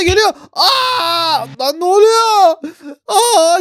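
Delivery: loud high frightened yells; panicked screaming. Real, not acted. Gamer screaming as the ballerina charges at him.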